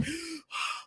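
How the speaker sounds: sharp mock gasp of a child; shocked gasp. Mom imitating her sensitive child hearing a threat, mock gasp. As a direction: gasp as if horrified. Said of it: "they would be like".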